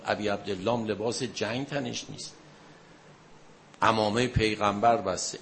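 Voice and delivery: normal talking voice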